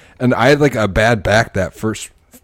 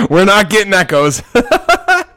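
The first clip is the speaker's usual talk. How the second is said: laughing; 13 semitones higher, 9 dB louder, slower than usual. Real, not acted. Laughing about the echo problem.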